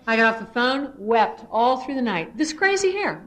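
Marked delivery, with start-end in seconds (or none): 2.4-3.2 crying. Real, not acted. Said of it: "Now I'm crying".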